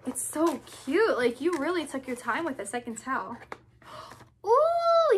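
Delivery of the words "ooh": excited ooh. Real, not acted. Excited at opening package.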